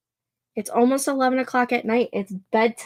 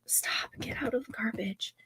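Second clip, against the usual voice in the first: unvoiced, much softer hiss at the dog; whispered scold; 13 dB quieter than usual. Real, not acted. To the dog in the garbage, whispered scold. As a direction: scold the dog without waking anyone.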